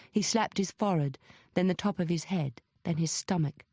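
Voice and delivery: usual speaking voice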